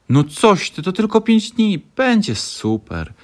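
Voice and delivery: high, loud upbeat encouragement; cheerful reassurance. A narrator's reading. Franio comforts his friend on the bus, loud and cheery. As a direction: cheer her up brightly. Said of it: "podsumował Franio i przyjacielsko".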